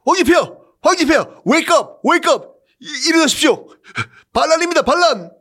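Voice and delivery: 훨씬 높고 크게 헐떡이며 외치기; 한밤중에 황제를 깨우듯 다급하게; 다급한 외침. Acted. 비서실장이 한밤에 헐레벌떡 외침. (황제를 깨우는 비서실장, 다급하게 높이 외침)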